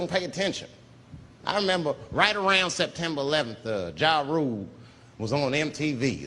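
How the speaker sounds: usual speaking voice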